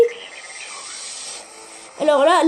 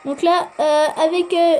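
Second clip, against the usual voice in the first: normal talking voice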